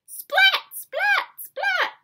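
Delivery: very high, loud squeaked bursts; high explosive splats; lightning splats. Acted. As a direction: crack each splat out like a lightning strike. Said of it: "He makes lightning".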